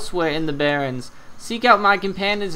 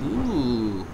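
ordinary talk

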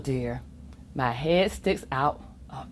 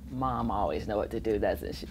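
quiet aside; 10 semitones lower, 8 dB quieter, faster than usual. Real, not acted. Aside to listener.

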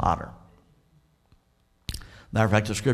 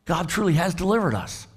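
higher, marveling cry of relief; amazed joy; 8 semitones higher than usual. Acted. Preacher voicing the boys realizing God saved them, higher and marveling. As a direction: let the wonder lift the voice.

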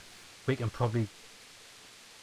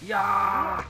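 triumphant shout; 8 semitones higher, 5 dB louder than usual. Real, not acted. Triumphant cry in fight.

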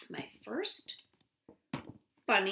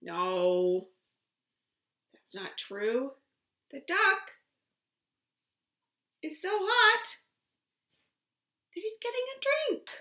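lively storybook voice, high and loud; reading to a toddler; 3 semitones higher, 6 dB louder than usual. Acted. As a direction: read it big for little ones. Reads picture book aloud.

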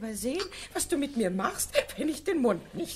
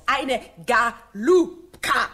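slow, loud, savoured insult; drawn-out taunt; 8 dB louder, much slower than usual. Acted. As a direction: stretch the insult out loudly.

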